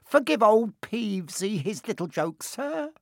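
high-pitched fawning apology; greasy. A narrator's reading. Peeves begs the invisible Baron's forgiveness, high greasy fawning. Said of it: "he said greasily".

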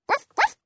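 high yappy dog barks. Acted. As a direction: bark like an excited little dog. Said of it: "Prince barks".

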